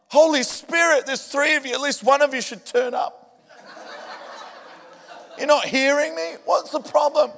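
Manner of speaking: high, half-laughing mock plea to a no-show Spirit; begging prayer. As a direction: whine it up high like a needy pray-er, chuckling. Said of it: "when you beg him".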